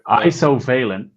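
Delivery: Isovalent as EYE-so-VAY-lent, slowly; slow, careful "eye-so-VAY-lent" for Isovalent; corrected pronunciation. Shown as a demonstration. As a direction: repeat Isovalent slowly with a long eye start. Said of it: "I call it".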